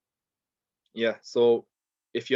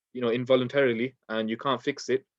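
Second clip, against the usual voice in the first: ordinary talk, nothing marked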